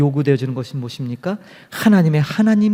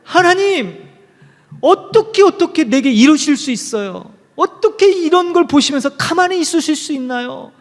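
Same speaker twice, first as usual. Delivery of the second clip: high, pleading protest to God, pitch climbing; protesting nun; 9 semitones higher than usual. Retold or imitated. Nun from the book protesting to God, high and anguished. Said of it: "하나님께 막 따졌대요".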